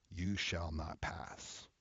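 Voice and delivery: slow, soft, ominous; ominous warning. Acted. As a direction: draw it out like a final verdict. Ominous warning ending the moral.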